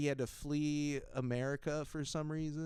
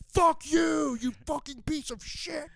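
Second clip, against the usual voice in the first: high, loud, mock-outraged shout; mock furious yell; 14 semitones higher, 8 dB louder, slower than usual. Hecklers screaming at Gary Johnson, loud mock rage. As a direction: bellow abuse like an enraged heckler.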